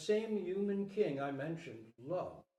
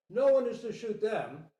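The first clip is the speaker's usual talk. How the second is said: royal command; 6 semitones higher, 9 dB louder than usual. Acted. King orders from stockade wall.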